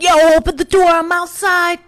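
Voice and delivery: high, loud gangster swagger; drunk hype voice. Retold or imitated. Drunk woman outside friend's flat, loud gangster swagger. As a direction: bark it at the door like a rapper. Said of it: "I've turned into [name]".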